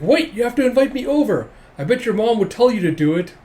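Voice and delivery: put-on high, loud childlike demand; urgent insistence. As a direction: press a friend for an invite. Anya insists on an invitation.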